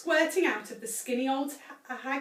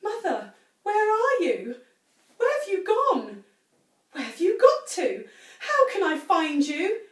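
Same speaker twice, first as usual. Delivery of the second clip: lightly higher puzzled calling; 7 semitones higher, 6 dB louder than usual. A narrator's reading. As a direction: call about, searching. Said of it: "with a puzzled look".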